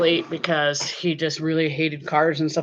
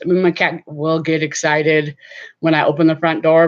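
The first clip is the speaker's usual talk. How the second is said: ordinary delivery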